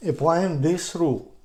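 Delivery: ordinary talk, nothing marked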